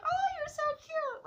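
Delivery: very high, loud cooing; mock cutesy gushing. Girl to supposed trick-or-treater, cooing over cuteness. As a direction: gush in an exaggerated squeaky-high voice.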